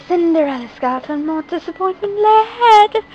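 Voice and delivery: ordinary delivery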